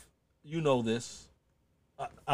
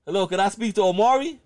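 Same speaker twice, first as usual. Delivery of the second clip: higher, louder phone request; imitated caller, voice pitched up; caller asking; 8 semitones higher, 7 dB louder than usual. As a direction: play the caller asking for a friend. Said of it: "I used to call there".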